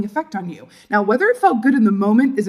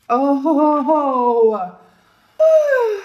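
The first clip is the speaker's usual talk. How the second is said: high, drawn-out crowing ooh; delighted "oh ho ho"; 6 semitones higher, 4 dB louder, much slower than usual. Real, not acted. Tarot reader spots a lucky card, delighted oh-ho-ho. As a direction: crow with delight, climbing high.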